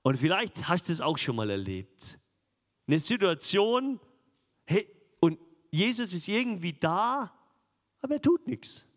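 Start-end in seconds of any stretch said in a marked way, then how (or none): 2.9-8.8 higher, pressing sermon voice, building urgency; fervent preaching. Acted. Preacher to congregation about a sleeping Jesus, fervent and raised. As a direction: urge the congregation on as the boat sinks.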